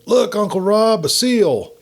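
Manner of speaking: put-on high, excited child's call; excited yell. Young Garrett calling to his uncle, excited yell. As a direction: point and shout like a thrilled little boy. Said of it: "jumped to his feet and yelled".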